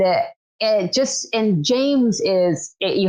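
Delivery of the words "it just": usual speaking voice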